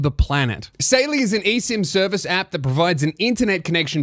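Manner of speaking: loud, punchy ad-read delivery; upbeat podcast sponsor pitch. Acted. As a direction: sell it to camera with steady, projected energy. Reads the sponsor message.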